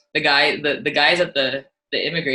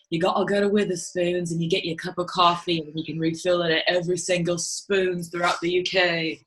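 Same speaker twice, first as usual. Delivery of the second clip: imitating eager Brits, a shade higher; lightly put-on British accent, pitched a bit higher; mock British enthusiasm; 4 semitones higher than usual. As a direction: play the keen local explaining pub chains in a light British voice. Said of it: "They're like".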